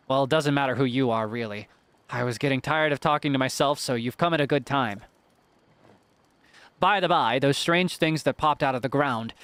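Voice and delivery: ordinary talk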